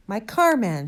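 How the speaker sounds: loud, high burst on the car; exasperated shout. A narrator's reading. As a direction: shout in outrage at the sergeant. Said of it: "shouted Mister Hazel".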